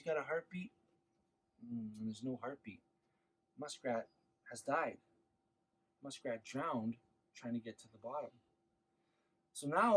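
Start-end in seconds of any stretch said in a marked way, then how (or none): 1.6-8.3 softly, sadly, trailing down; somber. A narrator's reading. Storyteller telling the children muskrat has drowned, hushed and sad. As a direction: let the loss sink in quietly.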